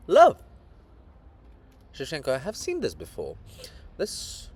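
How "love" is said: sudden high, loud cry of the word Love; chorus yelling out love; chorus shout. Acted. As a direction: burst out with it from across the street. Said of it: "Chorus from various corners of the streets".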